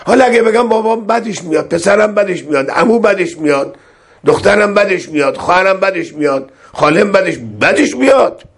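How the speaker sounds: high, whiny list of objecting relatives; mock complaints. Mimicking relatives who object. (ادای غر زدن اقوام با صدای زیر و تند)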